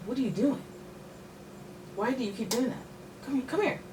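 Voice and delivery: high, exasperated pet scolding. Real, not acted. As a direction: tell the cat off, high-pitched. Scolding her cat mid-reading.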